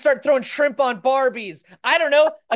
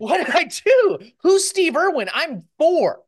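put-on higher and louder, a puzzled small child; confused little kid; 7 semitones higher, 4 dB louder, slower than usual. A kid named Oliver hearing Bluey blame him, confused child voice. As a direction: play a baffled four-year-old.

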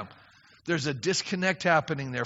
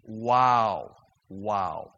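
amazed wow; 10 semitones lower, much slower than usual. Retold or imitated. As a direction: breathe out each wow in awe. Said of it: "all of a sudden you're going".

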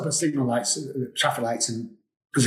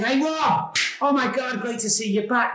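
cheery greeting; 10 semitones higher than usual. Retold or imitated. Chairman greets him warmly.

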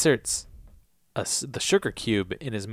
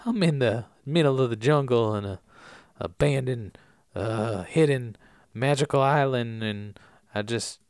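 spaced-out jungle hippie; 2 semitones lower than usual. Acted. Recapper playing the hermit in the teepee, spaced-out and mellow. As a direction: drift off mid-thought like a mellow loner.

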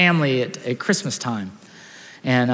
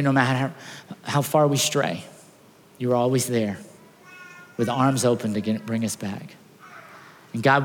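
earnest, rising pastoral prayer; heartfelt prayer. Acted. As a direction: pray over the congregation. Leads a prayer.

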